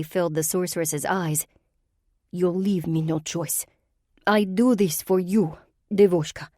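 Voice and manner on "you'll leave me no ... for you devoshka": put-on menacing Russian-accented sorceress. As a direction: threaten her as you raise your hand.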